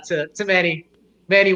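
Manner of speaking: ordinary talk, nothing marked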